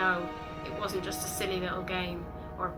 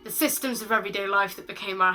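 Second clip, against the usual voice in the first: loud, raised-pitch poetic outburst; impassioned spoken word; 3 semitones higher, 7 dB louder than usual. Acted. Poet tells of people dying all around, impassioned loud recitation. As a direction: build to a forceful, driving cry of outrage. Poem erupts over the dying.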